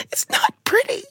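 high, wailing mock cry on "It's not pretty"; mock sob. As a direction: fake a tearful wail on "It's not pretty". Second take, mock sob.

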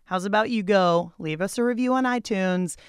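ordinary delivery

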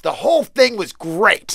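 high, drawn-out mock rave; gushing American. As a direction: stretch the praise like an adoring fan. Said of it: "Americans, you'll be like".

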